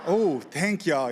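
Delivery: usual speaking voice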